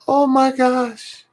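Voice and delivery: very high, slow, gushing oh my gosh; high drawn-out swoon; awed squeal. Retold or imitated. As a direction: melt at something adorable. Reacting to granddaughter's message.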